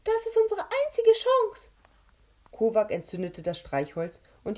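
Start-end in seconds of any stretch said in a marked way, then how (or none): 0.0-1.7 very high and loud, resolute; firm insistence. Acted. Captain K telling Rebecca it is their only chance, high loud and firm. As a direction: override her protest with conviction.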